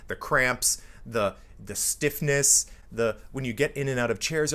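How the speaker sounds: usual speaking voice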